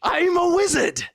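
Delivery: hammed-up wizard voice with a British lilt; mock wizard voice. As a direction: insist you're a wizard in a pompous mystic tone. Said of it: "You're doing more British now".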